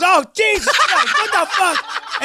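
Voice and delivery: high, shocked, laughing exclamation; shocked dad. Retold or imitated. Father seeing the condom, shocked high exclamation. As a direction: recoil in disbelief, slowly, through laughter. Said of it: "He goes".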